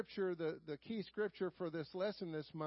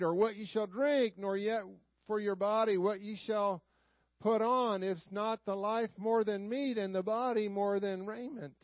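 louder, measured King James reading from the pulpit; solemn scripture reading; 5 dB louder than usual. Acted. Pastor reading Matthew to his congregation, solemn and declaiming. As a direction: read the old words out to the congregation with weight.

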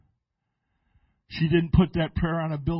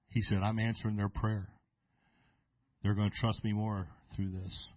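lower, hushed, solemn voice of God; voice of the Lord; 6 semitones lower, 6 dB quieter than usual. Retold or imitated. God answering the pastor's prayer, low and hushed. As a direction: speak as God, softly and with weight. Voicing God speaking to him.

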